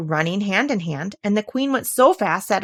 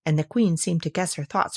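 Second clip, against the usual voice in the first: ordinary delivery, nothing marked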